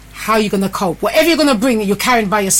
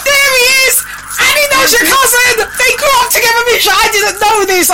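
excited; 11 semitones higher than usual. Real, not acted. Her son joins the live.

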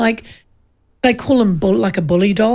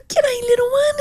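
put-on much higher, sweet baby-talk coo; baby talk to puppy. Greeting a sleepy puppy.

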